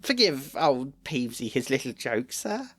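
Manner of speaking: high, fawning simpering ghost; oily nervous grovel; grovelling Peeves. A narrator's reading.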